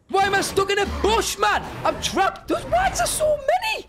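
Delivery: very high-pitched frantic yelling; trapped panic. Real, not acted. Player stuck in a bush among many creatures, panicked yell. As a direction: cry out in escalating panic.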